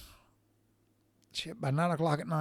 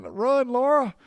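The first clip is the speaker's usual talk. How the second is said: high, louder mock yell; mock holler; 10 semitones higher, 5 dB louder, slower than usual. Husband jokingly yelling to his wife to flee, high mock holler. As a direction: warn her off with a joking holler. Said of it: "I'm gonna have to holler".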